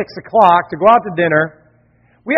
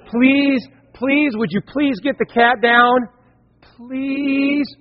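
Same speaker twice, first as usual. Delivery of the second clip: pleading daughter; 6 semitones higher, much slower than usual. Retold or imitated. Pastor imitates his daughter, high and pleading. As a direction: beg like a small child. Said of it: "She goes".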